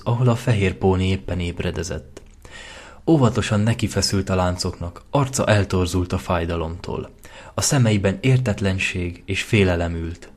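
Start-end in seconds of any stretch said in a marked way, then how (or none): none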